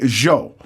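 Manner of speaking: "Joe" said as "Zho"; softened J. Retold or imitated. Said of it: "if it's the J thing".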